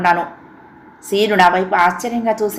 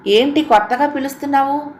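higher, puzzled question; surprised; 6 semitones higher than usual. A narrator's reading. Sister puzzled that Seenu calls her madam, surprised. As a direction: ask in mild surprise at the new name. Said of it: "ఆశ్చర్యంగా అన్నాను".

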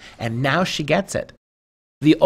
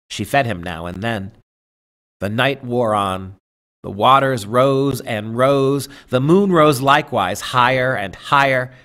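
story narration; 4 dB louder than usual. Acted. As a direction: read the tale aloud, letting each image land. Reads The Light Princess aloud.

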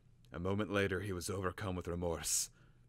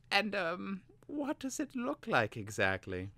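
put-on high, eager young apprentice voice; sly youth, pitched up and keen; Vilnix voice; 13 semitones higher than usual.

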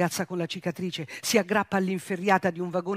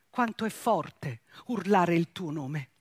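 ordinary delivery

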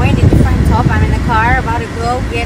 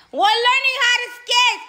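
high-pitched, thrilled reveal; excited announcement; 12 semitones higher, 4 dB quieter than usual. Real, not acted.

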